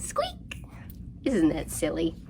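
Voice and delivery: normal talking voice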